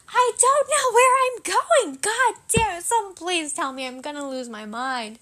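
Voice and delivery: acted-out fed-up cry that winds down; frustrated outburst. Daughter losing patience with parents over a surprise, frustrated. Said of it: "Really, really frustrated".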